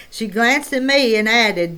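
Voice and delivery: ordinary delivery, nothing marked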